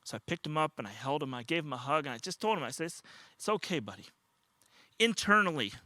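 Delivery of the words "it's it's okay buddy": soft, low, soothing murmur; gentle reassurance. Retold or imitated. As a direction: comfort a scared toddler, dropping softer. Grandfather comforting a frightened toddler.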